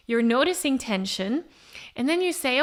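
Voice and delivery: ordinary delivery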